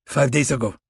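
Russian agent giving a plain answer, "Five days ago" said steadily; steady Russian accent on "Five days ago", level and unworried; calm Russian accent. A narrator's reading.

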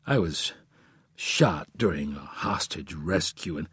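low, halting confession; defeated, embarrassed. A narrator's reading. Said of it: "Defeated and embarrassed, he said".

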